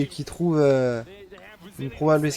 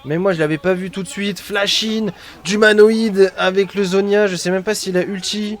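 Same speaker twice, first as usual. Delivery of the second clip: esports caster; 3 semitones higher, 6 dB louder than usual. Real, not acted. Live fight recap.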